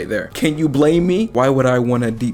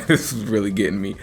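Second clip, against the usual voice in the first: ordinary delivery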